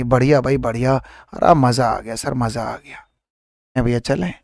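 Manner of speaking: ordinary delivery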